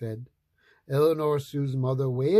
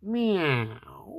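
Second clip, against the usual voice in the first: cat meow; 5 semitones higher than usual. A narrator's reading. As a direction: mew like a small kitty. Cat at the door.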